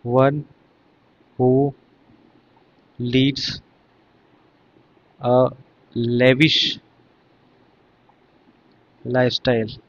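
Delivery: word by word with long pauses; dictation pace. Shown as a demonstration. Teacher dictating a definition for students to write, word by word. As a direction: dictate one word at a time, pausing. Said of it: "इसको आप ऐसे कह सकते हैं".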